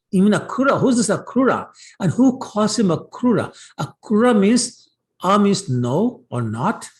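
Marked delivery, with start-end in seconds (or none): 0.1-3.6 high-pitched, acted-out outrage; indignant cursing. Gopis curse the man taking Krishna, acted indignation. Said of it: "then they started cursing".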